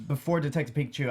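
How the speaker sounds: ordinary talk, nothing marked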